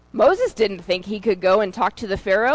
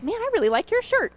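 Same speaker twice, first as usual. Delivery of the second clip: much higher, fast and bright passing compliment; cheery compliment; 10 semitones higher, faster than usual. Retold or imitated. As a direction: toss it off cheerfully to a stranger. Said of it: "walking by somebody and like".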